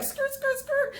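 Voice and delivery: very high comic skidding noise; rapid high screech effect; imitated tire screech. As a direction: squeal like tires rounding a corner. Imitates screeching round the corner.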